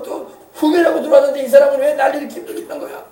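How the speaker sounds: high-pitched, exasperated workplace griping; hammed-up moaning about coworkers; mock grumbling. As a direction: act out a put-upon employee complaining. Acted workplace grumbler blaming colleagues.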